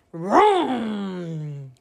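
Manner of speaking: low, held engine roar; long falling vroom. A narrator's reading. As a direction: imitate a truck revving away. Dump truck drives off.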